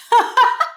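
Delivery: a high burst of laughter; laughing. A narrator's reading. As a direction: laugh out loud like a whole cafeteria. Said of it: "They laughed".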